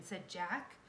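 ordinary delivery

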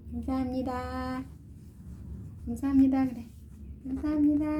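put-on high little-child voice for the doll; voicing the baby doll. Mother voices the youngest doll saying thanks, high child voice. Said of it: "막내가 인사할 거야?".

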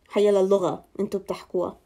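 ordinary talk

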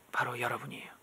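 soft unvoiced whisper; whispered sweet line. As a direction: breathe it like a secret. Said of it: "whispered address to viewers".

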